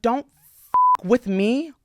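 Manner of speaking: slow, weighty warning; emphatic warning. Real, not acted. Warning people off their friends, slow and emphatic. Closing warning after the story.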